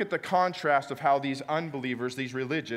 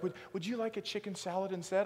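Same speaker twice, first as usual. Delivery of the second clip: soft clerk offer; 4 semitones higher, 8 dB quieter than usual. Retold or imitated. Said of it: "she's like".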